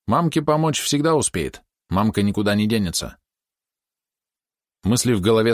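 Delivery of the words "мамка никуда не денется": usual speaking voice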